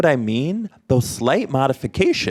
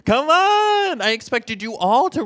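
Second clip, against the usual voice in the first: playful coaxing; 13 semitones higher than usual. Real, not acted. Coaxing class to raise hands.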